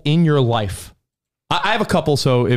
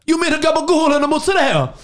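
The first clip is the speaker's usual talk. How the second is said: much higher mock Italian-American voice; hammed-up Jersey deli guy; exaggerated Italian guy; fat Italian guy; 12 semitones higher than usual. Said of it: "And he's like".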